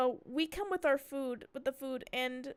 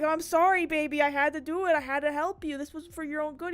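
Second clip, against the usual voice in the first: pleading apology; 2 semitones higher, 6 dB louder than usual. Retold or imitated. Acted apology to screaming cat.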